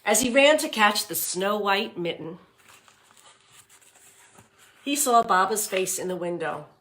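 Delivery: put-on read-aloud voice for young children; storybook reading. A narrator's reading. Teacher reading The Mitten to class, storybook voice. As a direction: narrate it warmly for the class.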